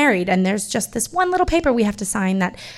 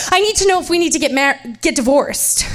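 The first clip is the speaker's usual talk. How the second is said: fast, loud and high, tripping over the words; nervous wife blurting to the clerk; blurted; 6 semitones higher, 5 dB louder than usual. Retold or imitated. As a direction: let it burst out before you can stop it. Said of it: "I blurt before I can stop myself".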